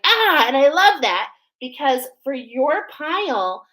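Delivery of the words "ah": delighted ah. Retold or imitated. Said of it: "I'm like".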